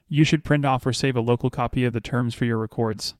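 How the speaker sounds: ordinary delivery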